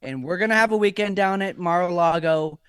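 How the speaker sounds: hammed-up Trump bragging and reassuring; mock president promising a Mar-a-Lago weekend; Trump impression. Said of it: "this is President Trump".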